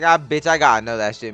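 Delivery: normal talking voice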